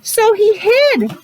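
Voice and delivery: high, slow "so he hid"; dramatic reveal. A narrator's reading. Reader slowing down for suspense with the kids. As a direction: draw it out for suspense. Dramatic story moment for children.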